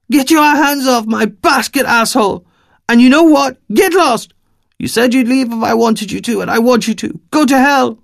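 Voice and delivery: very high, loud, furious Eastern European woman; furious yell. Acted. Furious woman chasing a stranger from her basket, yelling. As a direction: drive him off with rage. Woman screams him away.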